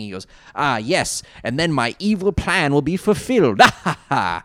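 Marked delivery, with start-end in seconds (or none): 0.5-3.6 loud hammy villain boast; carnival barker villain. Retold or imitated. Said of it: "he does talk like a carnival barker". 3.6-4.4 loud hammy villain laugh; evil laugh. Retold or imitated. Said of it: "Mine is an evil laugh".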